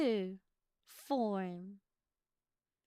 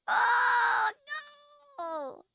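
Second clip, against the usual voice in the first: sudden high shout of dismay; real startled cry, much higher and louder; shocked cry; 11 dB louder than usual. Real, not acted. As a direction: yelp out loud in shock.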